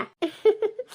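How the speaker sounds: a light, high laugh of relief. Real, not acted. Daughter-in-law laughs off her mother-in-law's apology, light laugh. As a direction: laugh it off gently.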